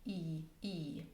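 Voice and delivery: "E" stretched long and soft. Shown as a demonstration. Teacher demonstrates the long E. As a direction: hold the long E. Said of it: "there's a long E".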